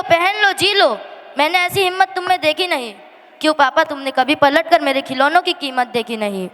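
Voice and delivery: normal talking voice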